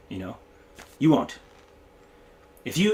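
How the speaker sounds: ordinary delivery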